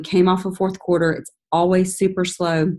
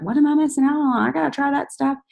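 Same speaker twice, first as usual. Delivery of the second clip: eager curious friend; 8 semitones higher, faster than usual. Shown as a demonstration. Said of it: "You want them to be like".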